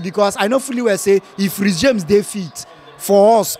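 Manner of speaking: normal talking voice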